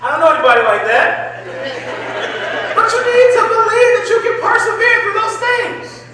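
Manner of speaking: rally the room like a pulpit preacher; preaching at full force. Acted. Speaker urging students to persevere through hardship, full-force preaching.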